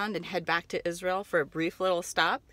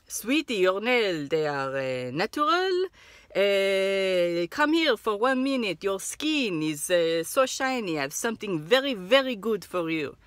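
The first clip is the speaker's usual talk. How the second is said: put-on Israeli accent; 3 dB louder, slower than usual. Mall kiosk seller pitching.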